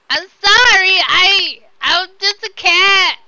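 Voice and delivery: loud, high, drawn-out mock wail; hammed-up pleading at full volume; wailed mock apology. Player as the cat apologising for the mess, mock wailing.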